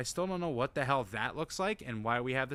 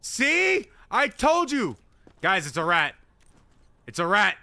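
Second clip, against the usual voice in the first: excited shouting; 11 semitones higher, 11 dB louder than usual. Real, not acted. Excited shouting about a rat.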